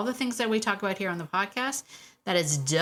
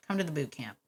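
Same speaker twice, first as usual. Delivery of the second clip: usual speaking voice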